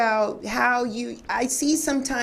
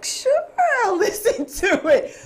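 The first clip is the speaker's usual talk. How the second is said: high, laughing, starstruck; giddy high acceptance; 11 semitones higher, 3 dB louder than usual. Retold or imitated. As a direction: squeal it out, laughing. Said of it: "I was like".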